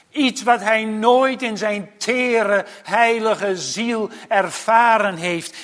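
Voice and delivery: hoge, krachtige preekstem; vurige preek. Acted. (vurig en met verheven stem prediken)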